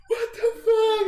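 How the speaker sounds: drawn-out, high crying wail; mock screaming and crying. Storyteller mimicking the pranked friend's breakdown, screaming and crying. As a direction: sob it out slowly, imitating hysterics. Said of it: "He's, like, screaming and crying".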